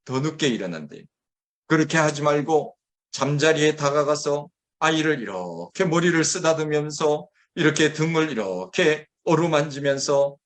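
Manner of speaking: usual speaking voice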